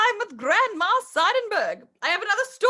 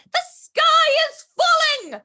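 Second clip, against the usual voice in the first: higher, louder, slow alarmed cry; mock panic; 6 semitones higher, 5 dB louder, slower than usual. Chicken Little panics.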